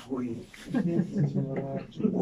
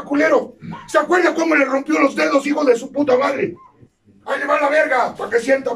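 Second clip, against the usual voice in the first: raised, shouting accusations; angry scolding rant; 5 semitones higher than usual. Acted. Reclamo furioso a otro adicto. (regaño furioso a gritos)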